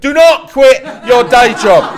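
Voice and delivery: loud, high, laughing warning; laughing shout. Retold or imitated. Billy warning the young poet, shouting loud through laughter.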